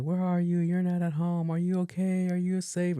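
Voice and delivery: high-pitched string of anxious questions; imitated worried mom. Mom checking on grown daughter out late, mock worried. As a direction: fret down the phone like a mother.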